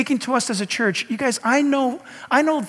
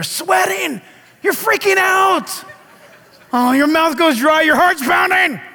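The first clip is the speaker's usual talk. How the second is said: much higher, louder, slower, near shouting; panicked; 8 semitones higher, 5 dB louder, slower than usual. Acted. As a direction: build the panic, voice climbing high. Describing panic before approaching stranger.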